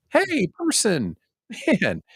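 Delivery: high, slow, syrupy greeting; sugary high welcome to a lapsed member; gushing host voice. Retold or imitated. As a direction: gush like an overeager host. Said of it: "I'm trying to be a host".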